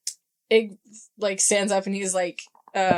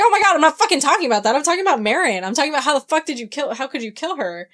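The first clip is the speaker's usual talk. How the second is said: high, loud, rapid acted tirade easing off at the end; exasperated fury; 7 semitones higher, 4 dB louder, much faster than usual. Ig confronts Lee over Marion's death, exasperated and angry. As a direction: burst out fast and high, acting out an angry accusation.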